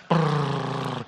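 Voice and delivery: buzzing lips like a mower engine; lawnmower noise. Retold or imitated. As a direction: rev it like a lawnmower. Imitating a lawnmower sound.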